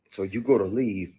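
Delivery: usual speaking voice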